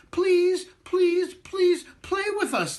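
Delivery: high, loud begging; children pleading with the matzah man. Acted. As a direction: beg him with every please. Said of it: "they pleaded".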